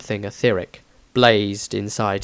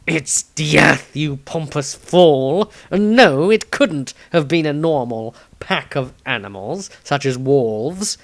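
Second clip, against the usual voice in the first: hint of a snarl, louder; 3 semitones higher, 4 dB louder, slower than usual. A narrator's reading. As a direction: snap at the pompous fool. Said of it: "Dieter snarled".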